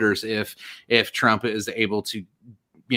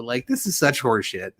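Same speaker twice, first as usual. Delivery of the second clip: ordinary delivery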